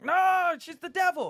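high, loud hammed-up outcry; mock outraged politician. Comedian mimicking politicians condemning her, mock outraged. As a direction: cry out in mock moral outrage.

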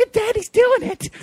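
put-on high childish singsong; creepy kid voice. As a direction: tease like an eerie little kid.

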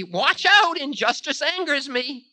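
piercingly high, angry voice of Time; sharp warning. A narrator's reading. Time warns Ravana of anger, sharp high voice. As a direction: start loud and high, sharply. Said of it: "said Time sharply".

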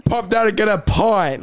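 slow, high English pub talk; brash English accent. Retold or imitated. English lad off to the pub, slow mock English accent.

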